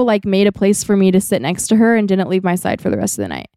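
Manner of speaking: ordinary talk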